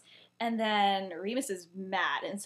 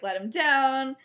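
louder, higher demand to stop; mildly raised voice, ordering a bully off; retold demand; 4 semitones higher, 7 dB louder, slower than usual. Lily orders bullies to stop.

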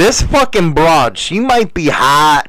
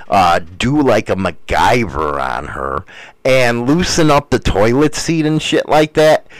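normal talking voice

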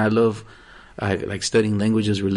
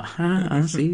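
higher mock-polite agreement; smiling non-understander; 5 semitones higher, 3 dB quieter, slower than usual. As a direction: pretend to follow, with an over-polite smile. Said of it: "that's when they do the smile".

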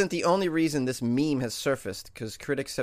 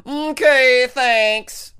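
role; 10 semitones higher, 9 dB louder, much slower than usual. Retold or imitated. Mock sign-off after cop voice.

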